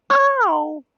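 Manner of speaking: squeaky pained yelp; high yelp. Pretend. Sudden yelp.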